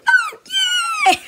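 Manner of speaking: loud, very high squealed cheer; over-the-top high celebration; mock joy. Mock joy at axe transplant.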